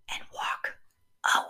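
soft whispered instruction; whispering. Acted. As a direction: whisper it gently.

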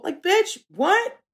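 like, what are you talking about; incredulous. Real, not acted. Incredulous outburst.

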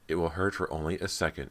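ordinary delivery, nothing marked

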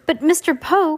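high-pitched indignant protest. A narrator's reading. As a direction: object indignantly, voice jumping up. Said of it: "Klaus said indignantly".

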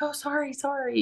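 a hint of the boyfriend's casual apology; mock apology. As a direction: toss off a quick fake sorry. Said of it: "he was like".

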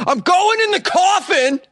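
excited squeal. Pretend. Mock patient climbing into coffin.

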